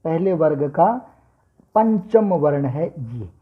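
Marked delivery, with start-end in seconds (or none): none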